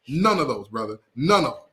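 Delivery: usual speaking voice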